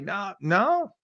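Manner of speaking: usual speaking voice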